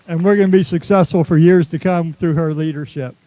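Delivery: loud, projected restatement; emphatic repeat. Real, not acted. Speaker repeating praise of the leader to the crowd, loud and emphatic. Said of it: "I'll repeat it".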